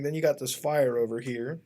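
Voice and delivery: ordinary delivery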